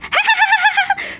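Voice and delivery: put-on shrill kid's laugh; high child giggle.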